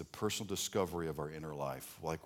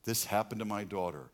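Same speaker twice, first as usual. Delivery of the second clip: usual speaking voice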